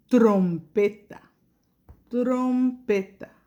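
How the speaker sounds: "trompeta" said slowly and clearly twice; teacher says "trompeta" slowly for the class; slow model word. Shown as a demonstration. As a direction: stretch it out for the kids. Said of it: "escuchas la doble".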